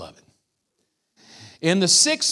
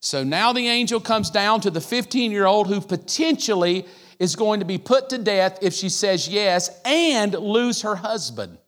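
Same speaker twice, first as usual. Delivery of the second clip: ordinary talk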